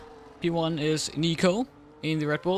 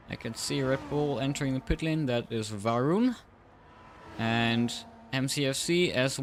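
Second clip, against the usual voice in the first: race commentary; 2 semitones higher than usual. Acted. Live race play-by-play.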